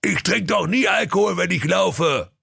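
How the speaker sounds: high put-on ogre voice, defensive; goofy ogre, pitched high; sheepish ogre. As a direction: play the caught speeder excusing himself. Ogre answers the officer.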